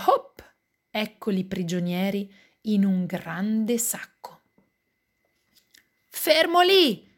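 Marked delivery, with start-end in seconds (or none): 6.1-7.1 high, loud, drawn-out command; grido. A narrator's reading. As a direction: yell to halt the fleeing wolf. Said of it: "grida papà". (urlo acuto e allungato)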